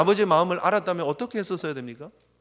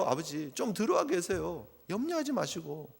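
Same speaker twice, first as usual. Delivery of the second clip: softer, coaxing; reassuring son; 8 dB quieter than usual. Retold or imitated. Elder son comforting his father, softer. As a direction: soothe the worried father gently. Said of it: "큰아들은 무엇을 해야 합니까?".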